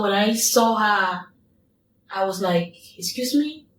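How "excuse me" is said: retold startled challenge, pitched much higher; shocked excuse me. To the strange woman on her couch, startled high-pitched challenge. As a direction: rise up in disbelief at the intruder.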